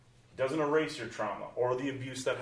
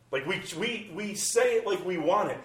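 mocking singsong, higher; mock cheery voice; 7 semitones higher, 4 dB louder than usual. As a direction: parody the cheerful confession, pitched up. Mocking how believers say it.

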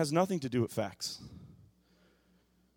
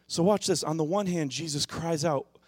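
normal talking voice

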